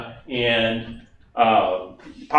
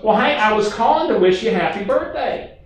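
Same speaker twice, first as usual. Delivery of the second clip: lightly raised friendly caller voice; a touch higher, chatty and sweet; Barbara's voice; 7 semitones higher than usual. Retold or imitated. Barbara calls Steve to wish happy birthday, lightly raised voice. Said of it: "I'm gonna talk like Barbara for a minute".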